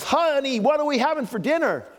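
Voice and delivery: husband one inch from his wife, asking loudly; loud mock call. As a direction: overdo it, the last exasperated try. Husband right beside her, final try.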